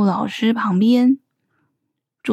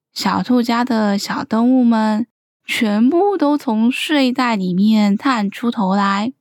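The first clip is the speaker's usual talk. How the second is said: usual speaking voice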